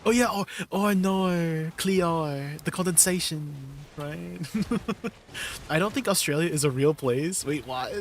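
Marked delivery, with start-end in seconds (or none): 0.7-3.5 playful, exaggerated Australian accent; teasing imitation of an Australian speaker; mock Australian accent. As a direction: ham up the Australian vowels. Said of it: "Teach me some Australian sayings".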